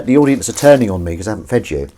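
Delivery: ordinary talk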